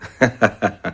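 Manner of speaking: short, high, loud burst of laughter; amused laugh. Acted. Biralbo hears the narrator was cheated, laughs loudly. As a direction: laugh knowingly at being cheated too.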